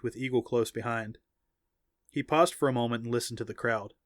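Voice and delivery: usual speaking voice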